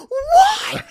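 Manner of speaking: very high, loud shocked what; shocked squeak. Retold or imitated. Friend hears she's suspended, high squeal. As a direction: squeal it in disbelief. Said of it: "And she was like".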